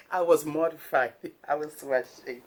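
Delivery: laughing through the words. Real, not acted. Vlogger laughing while retelling her embarrassment. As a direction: giggle while confessing.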